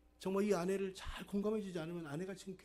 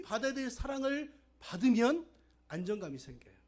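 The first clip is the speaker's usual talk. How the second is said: loud, raised-pitch sermon delivery; forceful preaching; 4 semitones higher, 10 dB louder than usual. Real, not acted. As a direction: drive the message home to the congregation. (힘 있게 외치는 설교)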